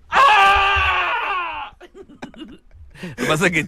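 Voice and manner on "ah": long high jungle call; Tarzan yell. Acted. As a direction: announce yourself with the ape man's holler. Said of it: "Ahí viene Tarzán". (grito agudo de Tarzán)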